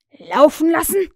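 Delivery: high, very loud, rising question; outraged shout. A narrator's reading. Hook hears the prisoner was freed, outraged shout. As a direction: yell it back in disbelief. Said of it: "schrie Hook".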